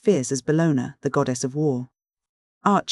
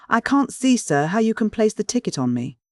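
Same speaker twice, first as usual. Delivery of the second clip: ordinary delivery, nothing marked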